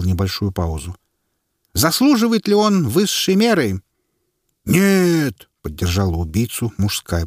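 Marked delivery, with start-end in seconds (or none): 1.7-3.8 herald's announcement. A narrator's reading. Herald addressing crowd. 4.7-5.5 loud, high shout of no; crowd shout. A narrator's reading. Men in the crowd shout back at the herald.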